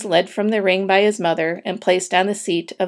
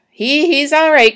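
high, loud insistent cry; raised voice, pitched well up; cried out; 7 semitones higher, 5 dB louder than usual. A narrator's reading. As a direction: call it out over the crowd. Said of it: "cried Mister Zuckerman".

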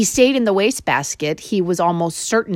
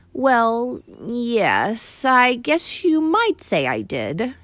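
higher, slow sheepish mouse; confessing Ralph; 5 semitones higher, much slower than usual. A narrator's reading. Mouse confessing to the boy, sheepish. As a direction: admit it sheepishly. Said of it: "confessed Ralph".

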